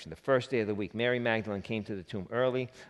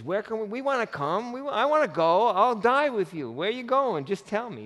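pleading, high and loud; 10 semitones higher, 5 dB louder than usual. Acted. Peter begging Jesus to let him come, high and urgent. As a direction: voice jumps much higher, loud and urgent.

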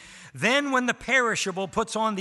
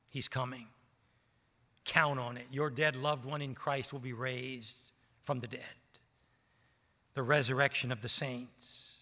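much softer and lower, close and intimate; hushed preaching; 5 semitones lower, 7 dB quieter than usual. Acted. Preacher quietly assuring his church, softer and lower. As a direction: bring the church in near, almost confiding.

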